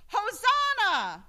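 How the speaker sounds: high, loud call for the congregation to echo; leading shout. Shown as a demonstration. Preacher leading the congregation's response, loud shout. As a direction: cry it out to lead the people. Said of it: "I'll say it first".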